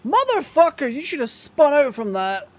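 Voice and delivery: high furious yell at another racer; angry shout. Real, not acted. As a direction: yell it, outraged. Raging at opponent car.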